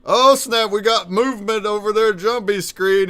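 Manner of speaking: loud, high excited burst; hyped-up sudden exclamation. Real, not acted. Sudden movement on screen.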